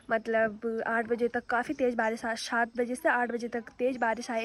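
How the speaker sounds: ordinary delivery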